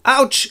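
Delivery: high, loud mock "ouch"; short hammed-up yelp of pain. Storyteller voices stabbed Frodo, high mock yelp.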